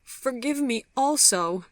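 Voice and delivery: high, tearful plea; crying hard. A narrator's reading. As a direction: plead through tears, voice lifted high. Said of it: "crying hard".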